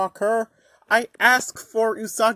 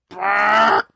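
buzzer sound; 10 dB louder than usual. Pretend. Wrong answer buzzer.

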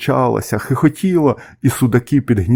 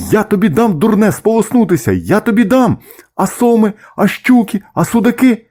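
high-pitched, agitated father's telling-off; indignant parental warning, pitch raised throughout; scolding; 8 semitones higher than usual. A narrator's reading. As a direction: scold a reckless child in alarm. Father gander scolds gosling.

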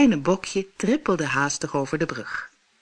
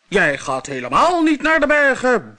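much louder, higher monster roar; thundering monster; 7 semitones higher, 6 dB louder than usual. Acted. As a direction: thunder it like an enraged troll. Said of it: "bulderde het monster".

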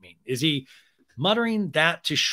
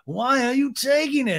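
high-pitched exasperated imitation; put-on high, scandalised voice; Lisa; 10 semitones higher, 3 dB louder than usual. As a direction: demand it in a high mock whine. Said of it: "Lisa's like".

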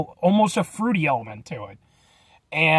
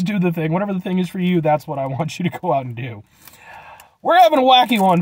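normal talking voice

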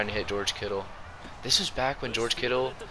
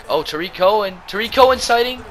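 excited shout; 11 semitones higher, 7 dB louder than usual. Real, not acted. Spotting a player.

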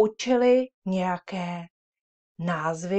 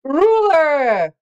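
shouted; 9 semitones higher, 14 dB louder than usual. Shown as a demonstration. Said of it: "A teď to zakřičíme".

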